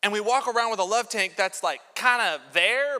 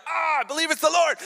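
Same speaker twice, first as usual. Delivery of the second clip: excited believer; 7 semitones higher, 3 dB louder than usual. Retold or imitated. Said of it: "you're like".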